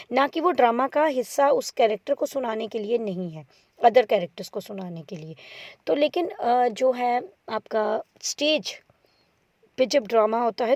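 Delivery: ordinary delivery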